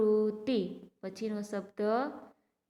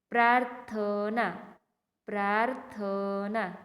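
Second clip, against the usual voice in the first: each syllable drawn out slowly for pupils; slow teacher model reading; slow clear reading; slower than usual. Shown as a demonstration. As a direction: read it slowly so the class can repeat. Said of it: "જોઈજો, હું વાંચું છું".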